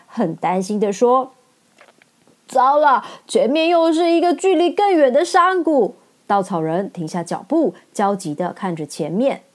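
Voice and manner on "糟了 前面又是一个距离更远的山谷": alarmed scarecrow. Acted. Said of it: "scarecrow alarmed".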